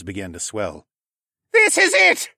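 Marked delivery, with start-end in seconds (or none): 1.5-2.3 high, very loud captain's cry; full-force storm shout. A narrator's reading. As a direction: yell over a screeching tempest. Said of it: "Nemus cried out over the screech of the tempest".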